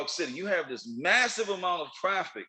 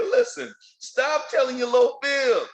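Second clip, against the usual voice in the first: scolding; 8 semitones higher, 5 dB louder than usual. Real, not acted. Scolding fib tellers.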